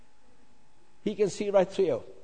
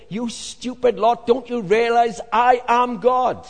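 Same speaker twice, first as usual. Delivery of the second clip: loud, slow, exasperated rebuke; loud slow telling-off; exasperated outburst; 6 semitones higher, 8 dB louder, slower than usual. Pretend. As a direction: scold them as a fed-up God. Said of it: "he'd say".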